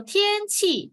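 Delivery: "天气" slow and high, level then falling; 慢慢高声示范天气; slow high tone demo. Shown as a demonstration. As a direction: stretch "天气" up high. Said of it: "天 is 第一声".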